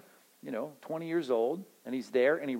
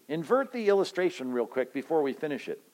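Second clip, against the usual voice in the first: ordinary delivery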